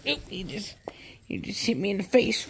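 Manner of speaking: fast hushed whisper. Real, not acted. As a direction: hush it right down to a whisper. Whispering after being hit.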